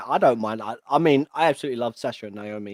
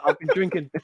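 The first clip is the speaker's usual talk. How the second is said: laughing joke; 5 semitones higher, 3 dB louder than usual. Real, not acted. Joking that he's been drinking.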